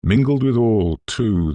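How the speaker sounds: ordinary delivery, nothing marked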